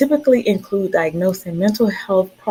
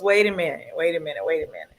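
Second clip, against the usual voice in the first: each "wait a minute" softer and lower, trailing off; fading repeat; 6 semitones lower, 7 dB quieter, faster than usual. Real, not acted. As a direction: let it die away bit by bit. Host mocks doctor's pill advice.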